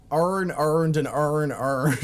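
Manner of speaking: imitated Baltimore accent, vowels smeared into "arn". Streamer mimicking how Baltimore people talk, heavy local accent. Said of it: "how people in Baltimore talk".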